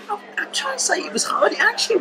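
breathy whisper, voice dropped away; whispered aside. Real, not acted. Whispered aside to the guest about hard childhood. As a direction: whisper it like a private confession.

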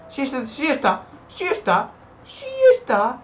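high, loud repeated cries; frantic excitement. Real, not acted. Player spotting the hidden wolf, rising frantic yells. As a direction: build to a shriek on spotting the enemy.

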